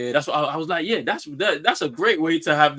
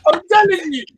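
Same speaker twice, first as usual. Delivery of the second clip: much higher and louder, laughing hype; hyped laughing; 10 semitones higher, 5 dB louder than usual. Real, not acted. Friend joins the hype laughing.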